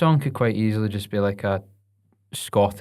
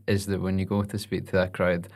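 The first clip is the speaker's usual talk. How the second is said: ordinary delivery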